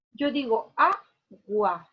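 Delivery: "agua" with the first syllable "a" stressed and drawn out slowly; teacher's slow stress demo. Shown as a demonstration. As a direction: lean on the opening "a" of "agua". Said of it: "La mayor fuerza de voz está aquí".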